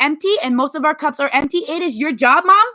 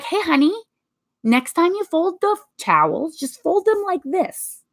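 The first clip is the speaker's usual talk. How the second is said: sweet request; 5 semitones higher than usual. Shown as a demonstration. Wife gently showing husband the towels, sweet and high. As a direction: ask nicely, like a sweet wife. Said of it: "You can be like".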